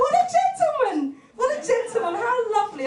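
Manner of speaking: high, sugary fake praise with a laugh; mock sarcastic gushing.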